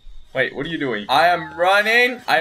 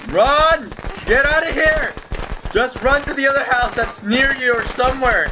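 high panicked shouting; panicking gamer; 8 semitones higher than usual. Real, not acted. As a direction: yell at them to run. [name] chasing, urging teammate.

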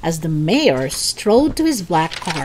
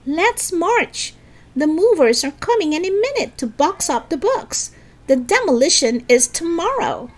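high, urgent rallying voice; rally them in a raised pitch, not full volume; yelling; 10 semitones higher than usual. Acted. Lisa urges friends before the movers come, yelling. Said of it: "Lisa yelled to them".

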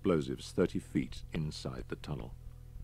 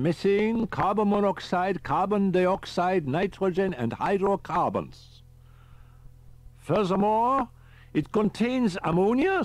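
lecturing professor; 7 dB louder than usual. A narrator's reading. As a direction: lecture him with eerie calm. Riker lectures about gas.

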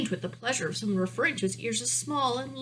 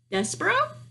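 princess voice; 10 semitones higher, 4 dB louder than usual. A narrator's reading. Princess voice in story.

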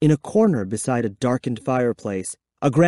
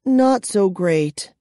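glum; 8 semitones higher, slower than usual. A narrator's reading. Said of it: "Kara replied glumly".

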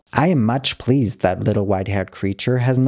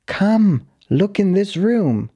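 excited urging; 9 semitones higher than usual. Pretend. Dog urging owner to follow.

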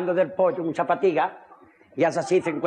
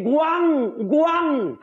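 wailing wife; 6 semitones higher than usual. Acted.

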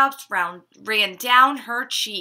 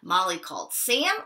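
usual speaking voice

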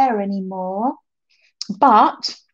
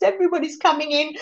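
ordinary talk

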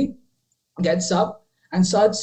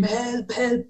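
high, held-back mock scream; much higher voice, mock cry for help; retold scream for help; 7 semitones higher, 4 dB quieter than usual. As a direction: imitate yelling for help without the volume. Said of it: "Then I scream".

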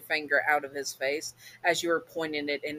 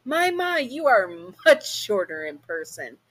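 higher, lightly voiced smug demon; Alistair's voice; 4 semitones higher, 3 dB louder than usual. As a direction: purr it with amused condescension. Demon greets Queen of Hell.

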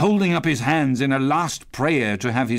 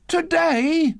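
put-on very high, questioning boy's voice; reply as an amazed lad; young boy. A narrator's reading. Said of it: "replied the boy".

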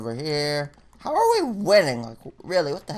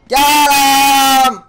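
loud, shouting at the game; raging gamer; 6 semitones higher, 6 dB louder, slower than usual. Real, not acted. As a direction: yell in outraged disbelief. Raging after dying.

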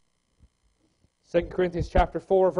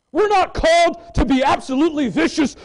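louder and higher, laughing it out; a preacher's loud, higher, laughing punchline; loud preaching; 7 semitones higher, 7 dB louder than usual. Real, not acted. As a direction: belt it with a laugh.

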